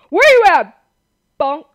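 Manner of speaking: loud, high taunting shout; real fired-up, high-pitched yell; aggressive calling out. Real, not acted. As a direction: holler for an enemy in hiding. Calling out to hunted mobs.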